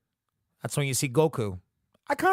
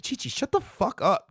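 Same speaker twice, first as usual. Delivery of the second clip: mock exasperated; 8 semitones higher than usual. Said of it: "it's just like".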